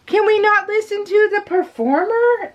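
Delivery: high, incredulous complaint at the chatterers; exasperated plea. Retold or imitated. Annoyed concertgoer to talkers behind her, high exasperated plea. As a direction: pitch it way up in disbelief. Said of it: "And I'm like".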